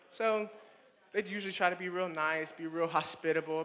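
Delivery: ordinary talk, nothing marked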